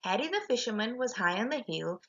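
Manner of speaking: usual speaking voice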